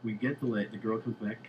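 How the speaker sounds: normal talking voice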